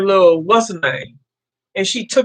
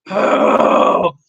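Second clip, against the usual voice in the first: big voiceless sigh of overwhelm; acted-out gust of relief-seeking breath; stressed exhale; 4 dB louder than usual. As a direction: blow out a long breath. Acts out her stressed reaction.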